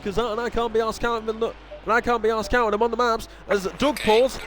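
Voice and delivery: ordinary talk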